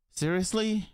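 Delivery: high incredulous seriously; you can't believe it; disbelief. Real, not acted. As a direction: reactor reacting in disbelief to the story. Reactor disbelieving the story.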